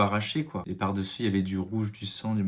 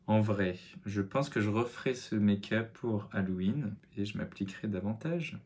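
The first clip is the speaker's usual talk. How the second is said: normal talking voice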